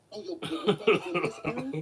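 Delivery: loud laughing taunt; laughing tease. Real, not acted. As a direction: laugh out the mama joke. Mocking opponent's mom photo.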